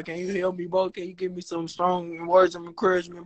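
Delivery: ordinary delivery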